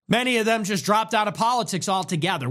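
ordinary talk

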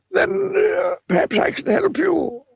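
high, rambling wizard voice; wordy wizard. A narrator's reading. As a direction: dither like a muddled old wizard. Wizard voice again.

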